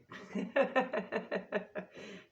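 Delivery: high, bright burst of real laughter. Real, not acted. Laughs at the card pig.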